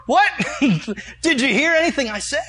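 high, loud comic indignation; incredulous outburst. Retold or imitated. Preacher retells being brushed off by the pastor, incredulous. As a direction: act out baffled protest at being ignored.

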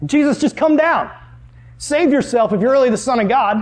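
high-pitched acted taunt from a heckler; jeering crowd. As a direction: call it out like a doubting crowd. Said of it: "voice of Satan being yelled out through these crowds".

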